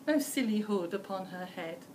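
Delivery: plain reading voice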